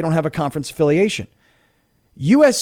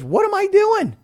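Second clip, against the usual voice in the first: exasperated self-question; 14 semitones higher than usual. Retold or imitated. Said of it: "all I'm thinking is".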